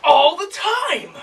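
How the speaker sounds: shrieking high, slow and laughing; over-the-top exasperated. Acted. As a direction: ham up the itchy customer. Actor in the commercial.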